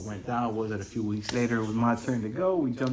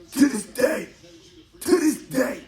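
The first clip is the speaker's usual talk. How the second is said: "To this day" cried out much higher and louder; exasperated outburst; 6 dB louder than usual. Real, not acted. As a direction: blow up on "To this day", near-shouting in disbelief. Builds emphasis by repeating.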